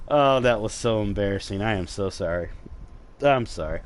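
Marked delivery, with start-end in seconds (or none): none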